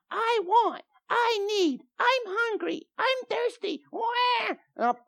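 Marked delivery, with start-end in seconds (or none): 0.1-3.8 put-on high, whining little child; squeaky demanding toddler voice; whiny kid voice. Father mimics his small demanding kids. 3.9-4.5 high, hammed-up kid's cry; mock baby wail. Mock kid crying after demands.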